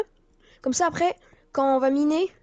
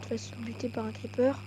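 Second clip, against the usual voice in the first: whisper; 8 dB quieter than usual. Real, not acted. Whispered warning.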